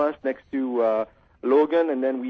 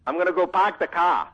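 put-on caller trying a Boston accent, r dropped; 4 semitones higher than usual. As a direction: say it like a Bostonian, "park the cah". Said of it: "I'm working on my Boston accent".

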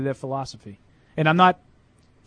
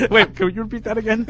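laughing; 4 semitones higher than usual. Real, not acted. Cracking up at the bit.

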